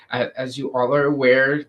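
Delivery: ordinary talk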